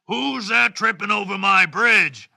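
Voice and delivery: loud storybook troll challenge; big, loud troll voice; troll's roar. Acted. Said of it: "roared the troll".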